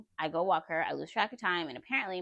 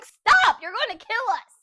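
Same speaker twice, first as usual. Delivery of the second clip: high, loud panicked yell retold; 14 semitones higher, 11 dB louder, slower than usual. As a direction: re-enact yelling at a reckless driver. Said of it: "I'm yelling".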